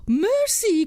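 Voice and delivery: loud high cry of Mercy; horrified cry. Acted. Mother sees vipers and toads, crying out loud and high. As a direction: cry out in horror. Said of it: "cried the mother".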